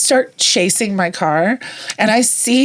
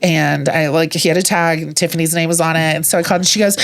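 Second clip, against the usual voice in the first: normal talking voice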